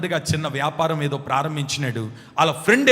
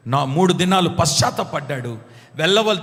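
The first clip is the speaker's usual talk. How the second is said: normal talking voice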